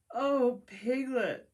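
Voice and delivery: loud, choked sobbing cry; a teary bear sobbing on the phone; between sobs. Acted. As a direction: cry his friend's name through tears. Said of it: "said Pooh between sobs".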